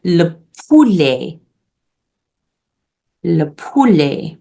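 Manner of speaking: slow, careful "le poulet" for repeating; French pronunciation. Shown as a demonstration. Teacher gives class the French word for chicken, French pronunciation. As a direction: say "poulet" as poo-LAY, drawn out. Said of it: "the word for chicken in French".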